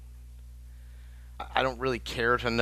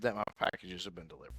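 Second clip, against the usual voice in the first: whispered; 5 semitones lower, 10 dB quieter, faster than usual. Real, not acted. Trailing complaint, measured whisper.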